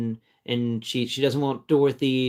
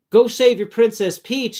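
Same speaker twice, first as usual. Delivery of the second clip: retold cheer; 8 semitones higher, 6 dB louder than usual. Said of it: "and he, like".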